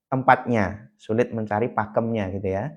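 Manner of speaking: ordinary talk, nothing marked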